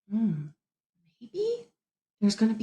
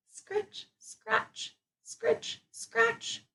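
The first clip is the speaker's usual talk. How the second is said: higher, softer scritch scratch; scratching sound words; 13 semitones higher, 11 dB quieter, much slower than usual. A narrator's reading. Teacher reading to class, soft mysterious sound words. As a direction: tiptoe through the scratching sounds.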